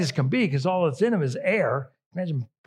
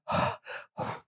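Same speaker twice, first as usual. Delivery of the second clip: imitated breathless puffs; out-of-breath panting; 6 dB quieter than usual. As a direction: pant like an exhausted sheep. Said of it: "completely out of breath".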